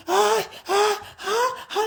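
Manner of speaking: high soft faked moans; hammed-up pleasure cries; mocking moans, high and soft. Imitating sex cries.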